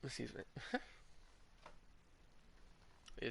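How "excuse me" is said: ordinary delivery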